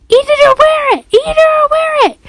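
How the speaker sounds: put-on very high, loud little-kid screech; screaming toddler. A narrator's reading. Little boy to his father, tantrum scream. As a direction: screech it like a child mid-tantrum. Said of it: "Fudge screamed".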